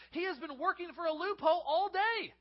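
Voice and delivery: ordinary talk, nothing marked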